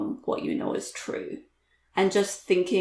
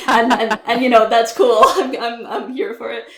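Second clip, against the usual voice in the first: laughing; 4 semitones higher, 11 dB louder than usual. Real, not acted. Laughing about mismatched outfits.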